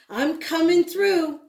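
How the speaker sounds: usual speaking voice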